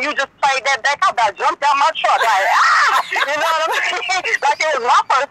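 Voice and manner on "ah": a laugh that bursts into a high shout; excited yelp. Retold or imitated. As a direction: jump out of your seat with a happy cry. Recalls hearing her song played.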